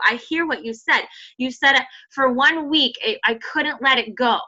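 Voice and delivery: usual speaking voice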